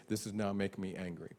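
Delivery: ordinary talk, nothing marked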